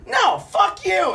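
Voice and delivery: slow yelled no, fuck you; retold shout. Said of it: "and I was like".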